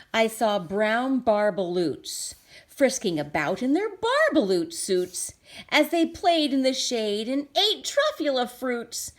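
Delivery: ordinary delivery, nothing marked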